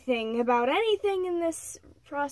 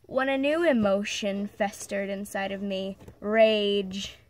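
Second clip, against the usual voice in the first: dramatic recital, leaning on key words; emphatic story reading; 5 dB louder than usual. Acted. Reading of rage at her mother's abuse, dramatic recital. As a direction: declaim a dark confessional poem with weight. Reads own dark story aloud.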